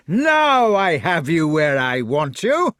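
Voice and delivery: slow, loud shout of triumph; fat knight's shout. A narrator's reading. Fat knight to Lancelot up the tree, shouting. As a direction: crow it loudly over a trapped foe. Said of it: "shouted the fat knight".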